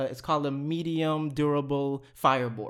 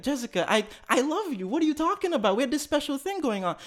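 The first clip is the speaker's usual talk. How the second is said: high-pitched lovelorn appeal; hammed-up hurt protest; mock pleading; 11 semitones higher than usual. Reenacts pleading with the accusing woman.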